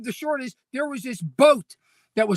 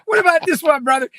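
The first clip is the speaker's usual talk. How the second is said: ordinary talk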